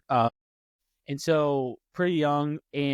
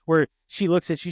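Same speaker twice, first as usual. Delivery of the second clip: usual speaking voice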